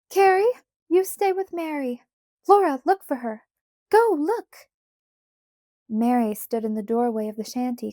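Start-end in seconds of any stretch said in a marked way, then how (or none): 0.1-4.7 high, loud, urgent orders; calling back. A narrator's reading. Ma runs after Pa, calling back urgent orders. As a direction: shout instructions back while hurrying off. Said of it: "calling back".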